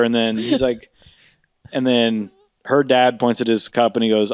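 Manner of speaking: normal talking voice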